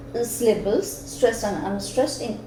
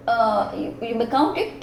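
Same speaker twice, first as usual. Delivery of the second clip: ordinary delivery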